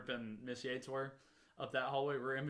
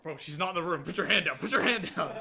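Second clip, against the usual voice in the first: laughing hush; 2 semitones higher, 8 dB louder than usual. Retold or imitated. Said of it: "Jackson's like".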